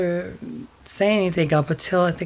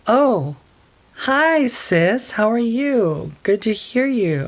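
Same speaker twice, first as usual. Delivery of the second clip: fake cheerful greeting; 6 semitones higher, slower than usual.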